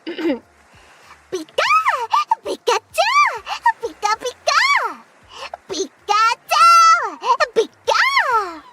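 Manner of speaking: high, squeaky Pikachu cries. Pretend. Streamer panics and switches to Pikachu, high and squeaky. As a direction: squeal it like the electric mouse. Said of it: "I'm gonna do a Pikachu voice instead".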